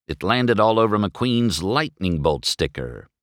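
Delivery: ordinary delivery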